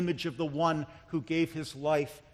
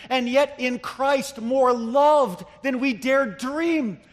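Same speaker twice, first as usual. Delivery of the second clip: high, loud, impassioned sermon delivery; full-force preaching; 5 semitones higher, 7 dB louder than usual. Real, not acted. Preacher telling the congregation how sinful and loved they are, impassioned. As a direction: build with fervor, stretching the key words.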